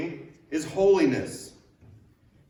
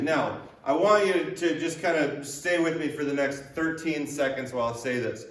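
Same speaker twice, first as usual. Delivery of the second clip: usual speaking voice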